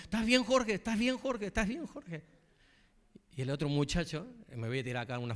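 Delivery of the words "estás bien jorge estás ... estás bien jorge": high, anxious repeated asking; worried coworkers calling. Retold or imitated. Coworkers phone the pastor on his break, high and anxious. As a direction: voice the worried callers, pitched up.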